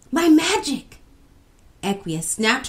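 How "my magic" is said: high, loud exclamation; excited yell. A narrator's reading. Equia remembering her magic, excited yell. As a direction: yell out the sudden idea. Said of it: "yelled Equia".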